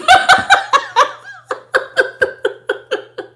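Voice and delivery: loud, high cackling laugh; high burst of laughter. Real, not acted. Host cracking up at the reading, loud high cackle.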